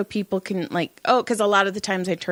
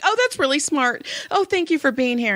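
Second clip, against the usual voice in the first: gushing client; 7 semitones higher than usual. Retold or imitated. Mimicking the gushing, frantic client.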